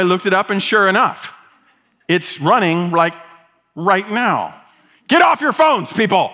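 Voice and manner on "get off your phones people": high, loud playful telling-off; mock scolding. Pastor teasing his congregation about phones, mock scolding. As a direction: call out sharply in mock reproach.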